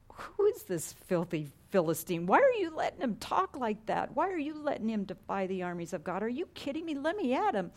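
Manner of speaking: put-on outraged young fighter spoiling for a fight; indignant challenge. David challenging the army over Goliath's taunts, indignant and incredulous.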